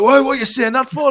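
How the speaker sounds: high, loud affronted challenge; mock indignant. Newcastle fans confronting a German fella, mock indignant. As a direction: imitate offended fans demanding an explanation.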